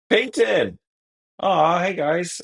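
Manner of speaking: high-pitched happy hello to friends; delighted greeting. Real, not acted. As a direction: greet them with a high, thrilled squeal of recognition. Greeting friends who just showed up.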